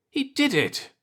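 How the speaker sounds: high excited burst; high, thrilled exclamation; exclaiming. A narrator's reading. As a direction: cry out with delight at the discovery. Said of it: "Ronal exclaimed".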